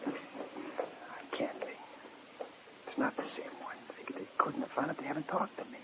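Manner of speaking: very soft, near-whispered fretting; hushed worry. Acted. Prisoner worrying aloud after lights out, hushed. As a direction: murmur it anxiously, almost to yourself. Prisoner frets to himself at night.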